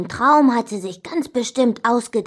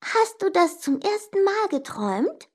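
high-voiced sister, rising question; 10 semitones higher, 3 dB quieter than usual. A narrator's reading. Sister quizzes brother about his dream, high questioning voice. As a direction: ask it high and pointedly. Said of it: "aber sie fragte nur".

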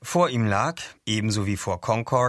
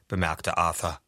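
ordinary delivery

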